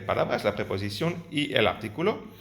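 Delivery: ordinary talk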